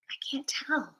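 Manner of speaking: breathy whisper to herself, can't make it out; whispered aside. Real, not acted. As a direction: whisper it under your breath, puzzled. Squinting at an emoji, aside.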